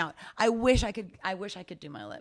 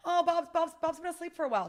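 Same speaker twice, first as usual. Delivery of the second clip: high and loud, cheery goodbye; excited cell chatter; 10 semitones higher, 13 dB louder than usual. Pretend. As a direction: wave off a friend brightly. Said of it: "the cells are like".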